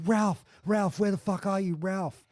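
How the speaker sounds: high, loud panicked call for the dog; retold frantic yell searching for a missing puppy. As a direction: shout his name across the yard in a panic. Calling frantically for lost dog.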